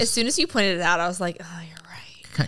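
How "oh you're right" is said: whispered, drawn out; breathy whisper of dawning agreement; hushed realization. Retold or imitated. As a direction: let the realization out in a slow whisper. Retells own sudden realization.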